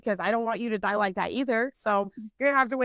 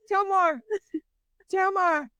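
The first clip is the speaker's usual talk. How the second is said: high, drawn-out call; imitated ride operator; 8 semitones higher than usual. Operator calling out to her.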